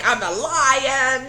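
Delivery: slow, high, proud little lion voice; voicing the cat. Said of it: "where he's like".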